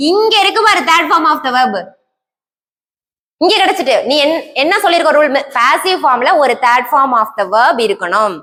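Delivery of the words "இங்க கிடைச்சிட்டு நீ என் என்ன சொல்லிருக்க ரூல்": ordinary talk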